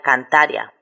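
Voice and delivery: "cantaria" stressed on the middle ta, ia as one syllable; diphthong pronunciation. Shown as a demonstration. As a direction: say "cantaria" as can-TA-rya. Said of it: "la pronunciación normal sería de diptongo".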